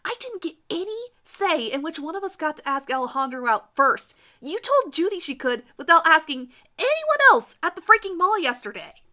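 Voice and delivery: high, loud, rising rant; girl in pink furiously accusing Judy; angry accusation. Acted. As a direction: build to a furious peak on freaking.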